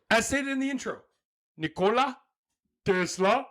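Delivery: a high put-on foreign accent for Nikola Tesla, badly faked; wrong accent attempt. Podcast host botching Tesla's name in a put-on foreign accent. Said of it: "That's not the right accent at all".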